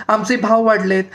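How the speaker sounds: shouted high, stress on भाव, bhaav hit hard; objects shouting. A narrator's reading. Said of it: "वस्तू एकदम ओरडायला लागल्या". (भाव वर जोर देत ओरडणे)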